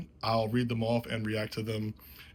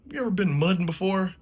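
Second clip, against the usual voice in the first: higher, louder, fast put-on friend voice; dead serious buddy; 9 semitones higher, 5 dB louder, faster than usual. Buddy asking Jason about mudding, high fast put-on voice. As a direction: ask it like a dare. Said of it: "looks at me dead ass serious and goes".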